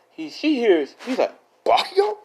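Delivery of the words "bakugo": surprised. Retold or imitated. Deku catches them.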